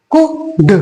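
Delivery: कुड split into "ku" and "d"; कुड, short "oo", then a separate d; reading of could. Shown as a demonstration. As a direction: say कुड in two parts, l silent. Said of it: "क्या पढ़ेंगे?".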